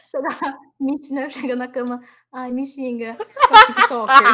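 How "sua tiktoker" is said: bem mais alto e agudo, estourando numa risada; xingamento rindo alto. Pretend. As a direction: solte o insulto rindo, de brincadeira. (xingando de brincadeira, aos gritos e risos)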